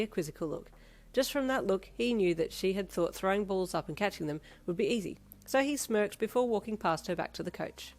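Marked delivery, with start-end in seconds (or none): none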